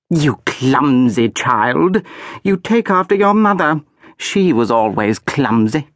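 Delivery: high, slow, contemptuous scolding; haughty archduchess. A narrator's reading. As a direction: belittle the clumsy child.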